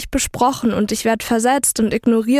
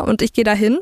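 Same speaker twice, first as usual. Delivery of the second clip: ordinary talk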